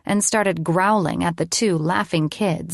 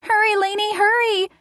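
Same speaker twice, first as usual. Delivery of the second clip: very high-pitched plea; urgent little kid. A narrator's reading. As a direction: urge her on like a captured child. Captured boy urges her on.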